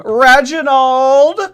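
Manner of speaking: drawn-out, high, loud call of the name; excited shout-out. Real, not acted. Streamer hailing a donor, drawn-out excited call. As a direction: sing out the donor's name slowly.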